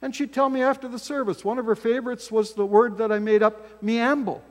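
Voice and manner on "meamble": ordinary talk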